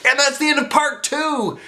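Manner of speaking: high, bright outro; cheery host sign-off. Acted. Host closing the reading video, cheery high sign-off. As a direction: announce the end brightly.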